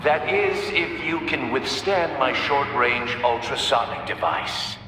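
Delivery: taunting through a tannoy; gleeful villain announcement; gloating over a speaker. Acted. Riddler gloats at Batman over the speakers.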